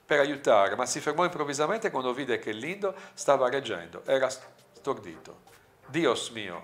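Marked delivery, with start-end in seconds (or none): none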